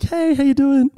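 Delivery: high, chirpy re-enacted hello; sing-out hello, pitched high; cheery greeting. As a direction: act out an unsuspecting, upbeat phone greeting. Re-enacts cheerful greeting before bad news.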